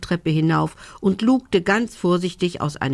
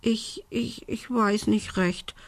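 soft, timid stutter on "Ich, ich, ich"; a little witch stammering, too scared to answer the raven; 6 semitones higher, 5 dB quieter than usual. A narrator's reading. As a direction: falter and trail off, unsure and scared. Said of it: "stotterte Belinda".

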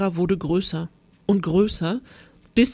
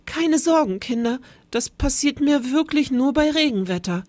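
high little teacher's voice from a monster; gentle monster calms the frightened children; small quiet voice; 6 semitones higher than usual. Acted. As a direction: reassure them small and high. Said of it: "mit Herrn Ungeheuers leiser Stimme".

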